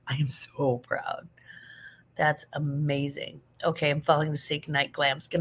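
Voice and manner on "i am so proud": soft whispered praise; soft, slower whisper of pride; tender whisper. Real, not acted. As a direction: whisper it like a proud friend. Praising a viewer's success.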